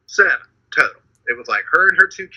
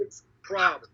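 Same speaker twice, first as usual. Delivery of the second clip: laughing; 4 dB quieter, slower than usual. Real, not acted. Laughing aside.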